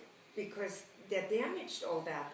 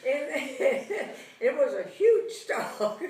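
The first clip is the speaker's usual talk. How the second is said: real laughter through the words, slowed; amused laugh while telling how big the dog was; 4 semitones higher, 9 dB louder than usual. Real, not acted. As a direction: laugh it out at the memory. Laughing at her childhood fear.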